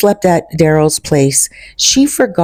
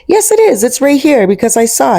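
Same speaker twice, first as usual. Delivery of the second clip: insistent; 8 semitones higher than usual. Retold or imitated. He insists the locket is there.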